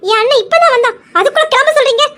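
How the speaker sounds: high, loud indignant pleading; angry protest. Acted. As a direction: fight back for your brother. Wife protests husband sending brother away. (கோபமான ஆதங்கக் குரல்)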